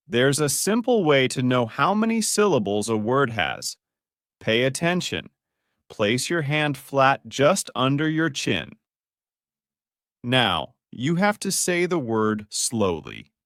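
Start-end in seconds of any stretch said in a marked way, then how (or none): none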